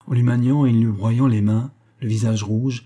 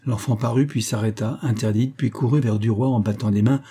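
ordinary delivery

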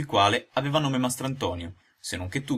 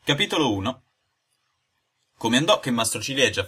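ordinary delivery, nothing marked

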